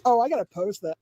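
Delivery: ordinary talk, nothing marked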